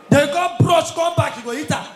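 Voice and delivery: loud call of the next move; loud dance hype; shouted dance call. Acted. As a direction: yell the step to the crowd. Calling dance moves.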